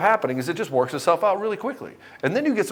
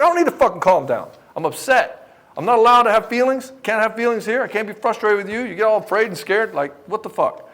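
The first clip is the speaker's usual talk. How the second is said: louder, higher outburst; angry client; 7 semitones higher, 4 dB louder than usual. Retold or imitated. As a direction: explode at the counselor.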